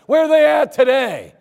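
a loud, searching acted question. Townsman asking after the blind beggars, loud questioning.

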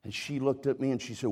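ordinary talk, nothing marked

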